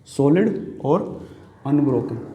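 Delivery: "Solid और unbroken" one word at a time, with pauses; slow, subdued "solid... और... unbroken", paced for writing; slow dictation. Shown as a demonstration. Teacher writing the meaning of monolith, slow dictation.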